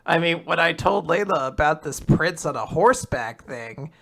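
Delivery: usual speaking voice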